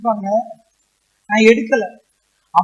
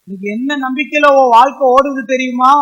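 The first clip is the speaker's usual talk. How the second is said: ordinary talk, nothing marked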